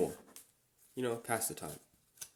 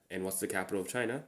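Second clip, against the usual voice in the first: normal talking voice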